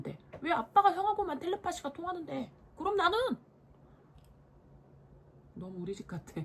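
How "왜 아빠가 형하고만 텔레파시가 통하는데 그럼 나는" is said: high, loud whiny protest; jealous protest. Acted. Little brother protesting dad only talks to big brother, high and loud. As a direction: demand it like a left-out little brother.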